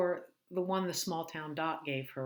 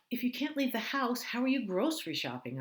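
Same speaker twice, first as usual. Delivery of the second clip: higher, louder voice for the sister; Lucy's worried voice; 9 semitones higher, 5 dB louder than usual. Acted. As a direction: ask it with sisterly concern.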